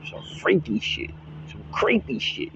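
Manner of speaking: creepy whisper. Acted. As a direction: whisper it like a horror-film creep. Mocking men watching clips.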